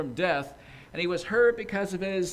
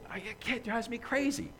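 ordinary talk

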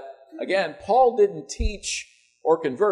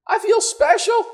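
much higher, mock-awed gasp; mock awe; 3 dB louder, slower than usual. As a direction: play it as a hammed-up wide-eyed fan. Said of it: "I would think".

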